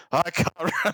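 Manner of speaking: lightly mimicked Scottish accent. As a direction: imitate the Scottish islander. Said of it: "make sure the accent goes for at least".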